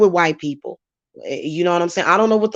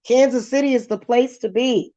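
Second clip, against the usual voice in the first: higher, hammed-up Kansas City voice; lightly putting on the Kansas City way of talking; mock Kansas City talk; 6 semitones higher than usual. Mocking the Kansas City people she hangs around, higher, hammed-up. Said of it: "now I'm talking like that".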